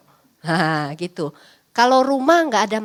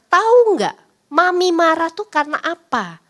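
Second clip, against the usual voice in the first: put-on higher, louder pointed question to the child; mother explaining; 5 semitones higher, 4 dB louder than usual. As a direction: Mami asking why she got angry. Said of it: "coba tanya pada anak". (Mami bertanya tegas kepada anak)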